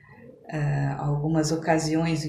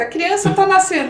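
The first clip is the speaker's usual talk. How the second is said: higher and louder, dramatic retelling; 12 semitones higher, 10 dB louder than usual. Retold or imitated. Telling her friend of her first delivery, dramatic. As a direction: build the drama of the birth. Recalling fainting at a birth.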